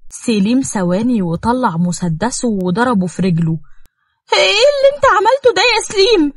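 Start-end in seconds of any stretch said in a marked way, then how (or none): 4.3-6.3 very high, fast panicked cry; horrified cry. Acted. Nour cries out after Selim shoots Amer. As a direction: scream it in horror.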